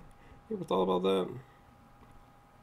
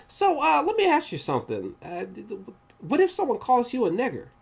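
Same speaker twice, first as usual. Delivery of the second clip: higher-pitched mock voice of the officer posing a blunt question; 11 semitones higher than usual. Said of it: "he was like".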